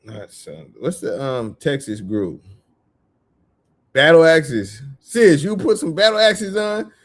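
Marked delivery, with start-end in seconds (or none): 5.0-6.9 high, loud disbelieving question; incredulous question. Retold or imitated. Retelling the car ride, a high loud shocked question to sis.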